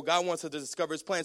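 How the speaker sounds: usual speaking voice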